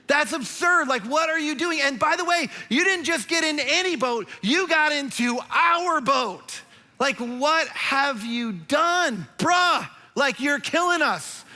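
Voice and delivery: sailors yelling at Jonah in disbelief; exasperated sailors. Acted. Sailors confront Jonah on boat.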